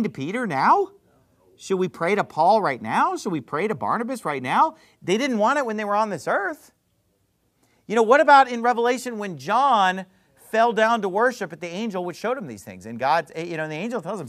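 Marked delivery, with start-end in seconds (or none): none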